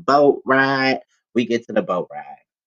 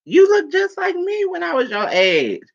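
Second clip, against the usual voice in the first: much higher voice for mom; mom's line. Retold or imitated. Quoting her mom's first words to her, voiced much higher. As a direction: play mom, pitched well up and fond. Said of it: "And she like".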